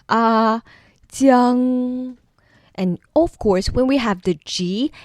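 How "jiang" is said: "Jiang" held high and level; first tone Jiang. Shown as a demonstration. Teacher showing that Jiang takes the high flat first tone. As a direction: hold Jiang flat like the dentist ah. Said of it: "the first one is the dentist".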